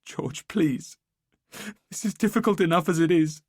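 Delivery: crying plea. Acted. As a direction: plead through tears. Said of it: "She starts crying again".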